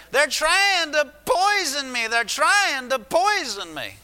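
high, drawn-out screech, loud and panicked; screechy demon voice. Retold or imitated. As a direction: screech it slowly like a tormenting spirit. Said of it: "he heard that same screechy voice".

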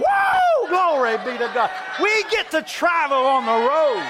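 high, loud joyful whoop broken with laughter; overflowing joy, shouting and laughing at once. Retold or imitated. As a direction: burst out laughing and shouting glory. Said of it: "And I started laughing".

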